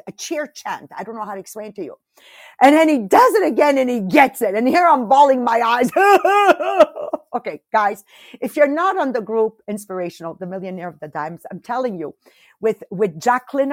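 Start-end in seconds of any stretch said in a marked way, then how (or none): 6.0-7.3 a bright laugh, high and loud. Real, not acted. Host laughing after admitting she cried, high and loud. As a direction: laugh at herself for crying.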